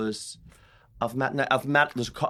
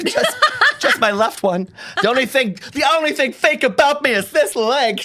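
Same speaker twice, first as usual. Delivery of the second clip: higher, louder hammed-up diva line; louder mock Real Housewives boast; mock reality star; 14 semitones higher, 10 dB louder than usual. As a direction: play it like a reality show star. Joking in a put-on voice.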